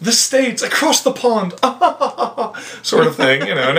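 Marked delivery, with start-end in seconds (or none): 0.0-1.5 high-pitched English caricature; mock British accent. English stranger guessing the visitor is American, mock British accent. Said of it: "in England". 1.6-2.8 high put-on society laugh; mock English titter. English stranger amused by own remark, mock titter.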